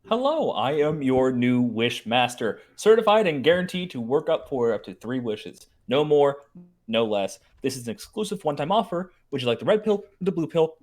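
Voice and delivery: loud salesman spiel, announcer-style; sales pitch. Acted. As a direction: pitch it like an eager insurance salesman. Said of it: "let's start from the top".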